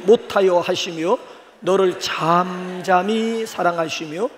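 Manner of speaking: slow, solemn reading of scripture. Acted. 회중에게 스바냐 말씀을 천천히 낭독하는 목사. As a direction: read the verse slowly and reverently. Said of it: "스바냐 한번 삼 장 십칠 절 한번 보실래요?".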